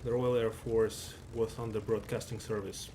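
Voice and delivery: loud, bright-paced broadcast welcome; announcer intro. Acted. Caster greeting stream viewers at match start, loud announcer voice. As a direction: open the show like a tournament announcer.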